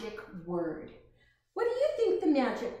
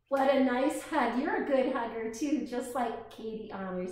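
plain reading voice

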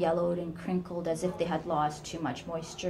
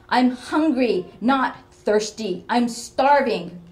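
slow, much louder and higher; furious demand; 6 semitones higher, 12 dB louder, slower than usual. Acted. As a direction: hammer out each complaint. Mother demands her supper.

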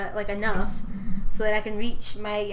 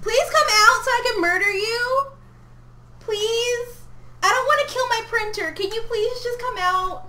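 high, whiny begging; panicked pleading; 10 semitones higher than usual. Real, not acted. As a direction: beg the hidden bug in a high voice. Pleading with a hiding bug.

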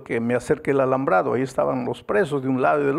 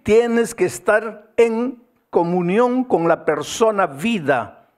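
loud, high, urgent preaching; impassioned preaching; 7 semitones higher, 4 dB louder than usual. Acted. Pastor urging the congregation, loud and high. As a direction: drive the point home from the pulpit.

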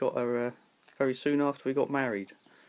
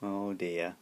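ordinary delivery

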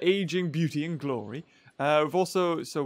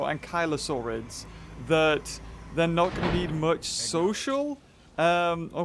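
ordinary talk

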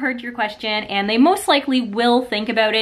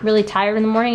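normal talking voice

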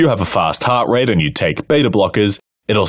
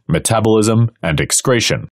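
normal talking voice

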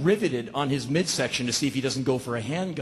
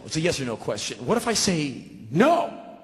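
ordinary delivery

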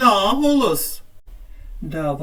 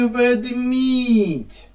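"ведмідь" with a long, stressed мідь; stress on second syllable; 4 semitones higher than usual. Shown as a demonstration. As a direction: hold the final syllable of "ведмідь". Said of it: "на другий".

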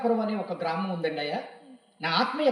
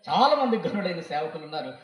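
normal talking voice

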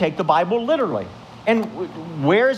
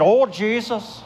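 put-on Irish accent; 5 semitones higher, slower than usual. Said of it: "you know, the accent".

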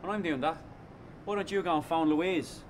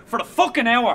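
sudden loud angry snap; bitter shout at his mate; angry outburst; 6 semitones higher, 5 dB louder than usual. Acted. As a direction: blurt it out furious.

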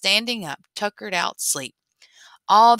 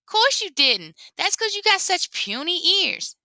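higher, mocking trickster voice; teasing rabbit; 11 semitones higher than usual. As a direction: tease him about his ears. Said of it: "said Lapin".

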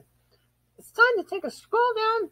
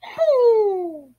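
whoop; 2 semitones higher, 7 dB louder than usual. Acted. Sudden whoop mid-fight.

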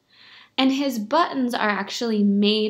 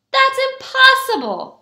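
high, loud exclamation; disbelieving shout; 7 dB louder than usual. A narrator's reading. People in the houses hearing the news, high shout. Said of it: "shouted the people in the houses".

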